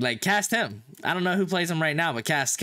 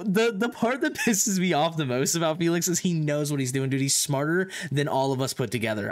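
usual speaking voice

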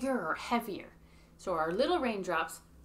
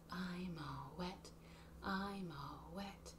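whispered line of the song; 15 dB quieter, much slower than usual. Shown as a demonstration. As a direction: breathe it like a secret.

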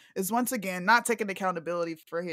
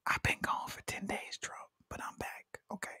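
Ruby whispering to Trump; 2 semitones higher, 6 dB quieter than usual. Pretend. Ruby whispering to Trump to drop his phone. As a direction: whisper a gentle plea to put the phone down.

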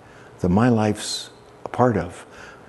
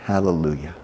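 usual speaking voice

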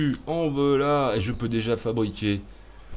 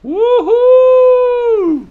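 excited whoop; 15 dB louder than usual. Real, not acted. Cheering after game reward.

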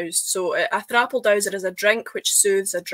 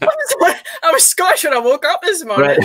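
loud, high-pitched mock outrage; hammed-up indignant comeback; indignant retort; 9 semitones higher, 7 dB louder than usual. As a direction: exclaim it in incredulous protest, pitch leaping up. Acted retort to doubting commenters.

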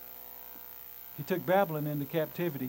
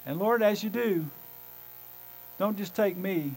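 normal talking voice

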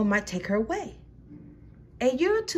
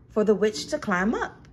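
ordinary delivery, nothing marked

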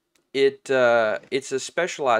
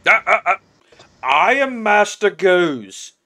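loud, higher, self-important orc voice; puffed-up henchman setting someone straight; indignant orc; 6 semitones higher, 9 dB louder than usual. Acted. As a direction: correct him indignantly. Plays the orc.

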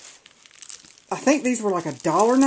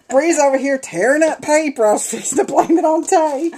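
amused, laughing; 6 semitones higher than usual. Real, not acted. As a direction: tell on the culprit while cracking up. Laughing at pet tearing paper.

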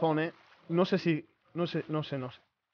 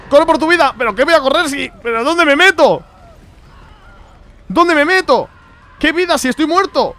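higher, louder frantic cries; real terror; 9 semitones higher, 10 dB louder than usual. Real, not acted. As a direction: shout in terror while being chased. Fleeing monster in horror game.

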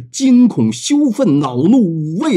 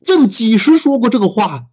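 roaring emperor; 7 semitones higher than usual. Acted. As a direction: roar the question at him. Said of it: "狂吼着说".

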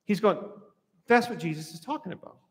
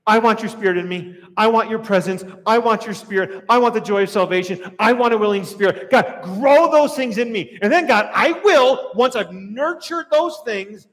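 loud, insistent plea building in force; fervent prayer; 3 semitones higher, 6 dB louder than usual. Acted.

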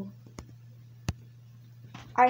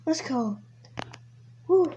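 ordinary talk

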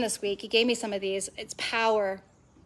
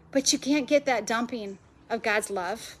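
ordinary delivery

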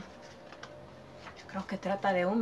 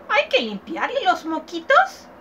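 put-on much higher, louder old woman's question; old woman quizzing the child; 8 semitones higher, 4 dB louder than usual. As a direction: squeak it like a fussy old lady.

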